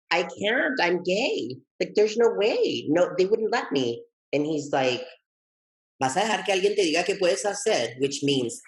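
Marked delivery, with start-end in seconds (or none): 0.1-4.0 higher-pitched, insistent objection; retold protest. Retelling own protest to husband. 6.0-8.0 higher, quick Spanish challenge; retold sergeant-major husband. Husband challenging his doubting partner in Spanish, imitated sergeant major. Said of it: "he's a retired sergeant major".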